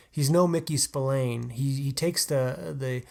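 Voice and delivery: normal talking voice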